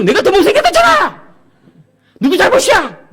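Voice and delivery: high, loud tearful yell; a son yelling at his dad whose fault it is; wailing teen shout. Retold or imitated. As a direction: howl it like a sobbing teenager.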